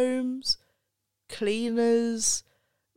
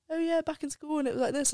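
mock chirpy; 8 semitones higher, faster than usual. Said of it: "a faux pas to be like".

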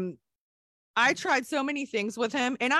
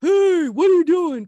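loud, higher yell of indignation; angry yell; 6 semitones higher, 10 dB louder than usual. Retold or imitated. Angry kids scolding her for fetching adults, angry yell. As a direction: snap at the kid who snitched. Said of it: "people would be so mad at me".

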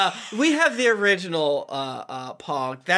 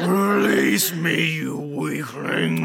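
menacing creature voice; 3 semitones lower, much slower than usual. Acted. Acting a monster's taunt.

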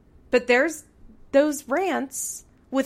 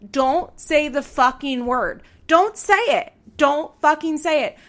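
real outrage, louder and higher; enraged; 5 semitones higher, 6 dB louder than usual. Real, not acted. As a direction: yell it at the screen, fed up. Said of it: "I was honestly enraged".

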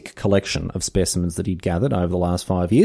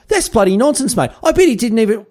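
much higher, squeaky affronted protest; mock indignation; 12 semitones higher, 3 dB louder than usual. Host scolds Darwin for knocking Australian trees, in hammed-up high-pitched indignation. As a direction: tell Darwin off like an offended local.

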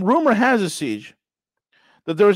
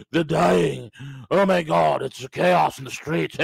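mocking Western media; 6 semitones lower, slower than usual. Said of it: "the Western media".

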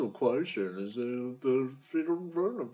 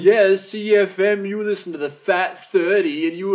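higher, louder, mock-accusing taunt; teasing accusation; 6 semitones higher, 7 dB louder than usual. Real, not acted. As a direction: needle your mate about his radio habit. Teasing friend about radio.

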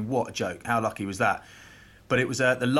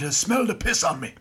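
higher, lightly imitated Irish teammate; Wally; 6 semitones higher than usual. Hungover prop explaining himself to young teammate, imitated Irish voice. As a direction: confide it in a mock Irish voice. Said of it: "that's what Wally sounds like".

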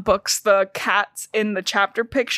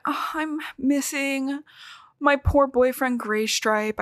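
mock lovesick pining; 4 semitones higher, 3 dB quieter, slower than usual. Fireheart pining for Gray Stripe.

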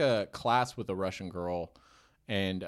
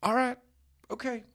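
high-pitched mock country uncle giving in; 10 semitones higher, 5 dB louder than usual. Retelling the evicted uncle's goodbye, high hammed-up country voice. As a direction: put on a resigned uncle, voice lifted high. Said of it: "And he's like".

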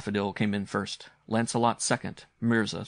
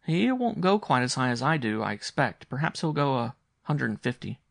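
put-on higher child voice, matter-of-fact; earnest young boy; 5 semitones higher, 4 dB louder than usual. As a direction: answer uncle as a serious little boy. Boy discusses Bassett's bet.

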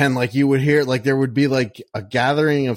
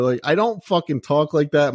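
ordinary delivery

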